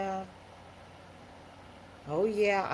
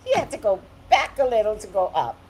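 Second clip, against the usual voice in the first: ordinary delivery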